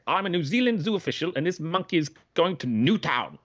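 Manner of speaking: wobbly mock-Kiwi impersonation of a zoo official; bad New Zealand accent. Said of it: "I cannot do a New Zealand accent".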